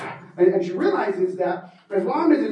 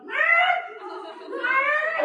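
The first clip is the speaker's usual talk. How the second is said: monkey screech. Acted. Monkeys attacking.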